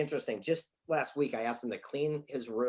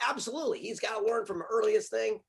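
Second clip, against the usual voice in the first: high-pitched retold insistence; confident mock-righteous reply; emphatic certainty; 9 semitones higher than usual. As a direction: answer as if it were obvious, pitched well up. Retells his confident past answer.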